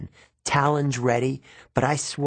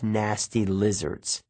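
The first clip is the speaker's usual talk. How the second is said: usual speaking voice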